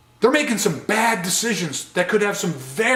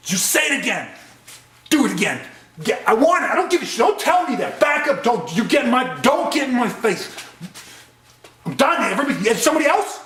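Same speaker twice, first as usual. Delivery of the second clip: crisis-level shouting; 5 semitones higher than usual. Shown as a demonstration. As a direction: yell at everyone, picking a fight. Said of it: "you can see the deterioration start to happen".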